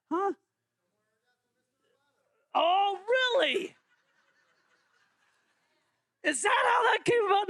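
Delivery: surprised. Real, not acted. Leader reacts to a surprising answer, high and amused. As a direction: act amazed at the answer.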